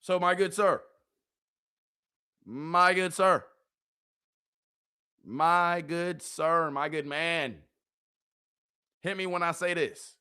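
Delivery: ordinary delivery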